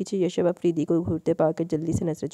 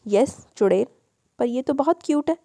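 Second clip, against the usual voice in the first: lightly voiced innocent little boy; a child sweetly disagreeing with his uncle; 5 semitones higher than usual. A narrator's reading. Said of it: "वो मासूमियत से बोला".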